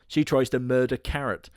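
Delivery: ordinary talk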